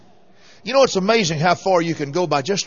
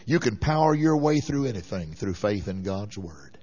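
low and quiet; 7 semitones lower, 7 dB quieter than usual. Shown as a demonstration. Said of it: "repeats the line".

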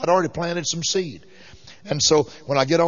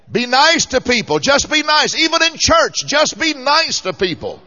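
full-force preaching; 7 semitones higher, 4 dB louder than usual. Acted. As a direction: preach it out, rising and ringing.

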